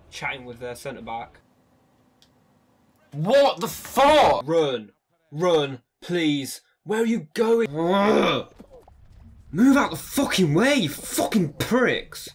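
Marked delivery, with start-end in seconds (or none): 3.1-4.4 loud, high, slow outraged yell; raging gamer. Real, not acted. Rage at game. 4.5-12.3 raging gamer. Real, not acted. Yelling at players.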